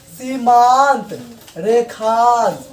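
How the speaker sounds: सीमांत and रेखांश read slowly, each syllable held; slow demo pronunciation. Shown as a demonstration. Teacher reading example words slowly to students. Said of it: "अगर इसका हम उच्चारण करते हैं".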